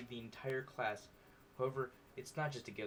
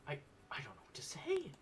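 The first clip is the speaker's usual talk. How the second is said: breathless whisper; stunned whisper; 4 semitones higher than usual. Acted. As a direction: whisper it, lost for words. Stunned reply.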